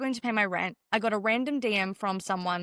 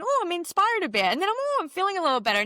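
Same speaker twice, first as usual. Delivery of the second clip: lifted inner voice; 7 semitones higher, faster than usual. Retold or imitated.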